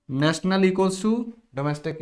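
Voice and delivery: very slow, word by word; slow formula dictation. Shown as a demonstration. Repeating formula slowly.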